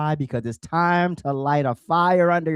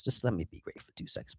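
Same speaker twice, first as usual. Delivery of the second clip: faster, under his breath; whispered to himself; 2 semitones lower, 14 dB quieter, faster than usual. Real, not acted. Host fussing with his gear, whispering to himself. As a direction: whisper it to yourself while fixing the setup. Host fumbling setup, muttering to self.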